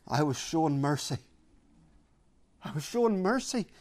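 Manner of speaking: softly marvelling as Paul; hushed, mildly disbelieving repetition; quiet incredulity. Acted. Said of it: "You can hear the incredulity in Paul's voice".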